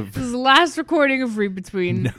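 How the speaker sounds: loud joking send-off; mock farewell. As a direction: announce it like a grand exit.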